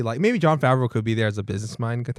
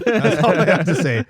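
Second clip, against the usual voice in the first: laughing; 7 semitones higher, 4 dB louder than usual. Real, not acted. Still laughing after witch laugh.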